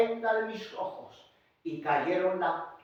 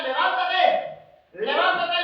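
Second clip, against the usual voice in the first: high, raised commanding call; urgent command; 11 semitones higher, 9 dB louder, slower than usual. Acted. Preacher voicing the Lord waking the pastor, urgent command. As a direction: call it out as an order to rise.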